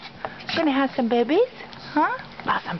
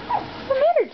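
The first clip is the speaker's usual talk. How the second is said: high pet talk. Real, not acted. Woman to her dog, high baby talk.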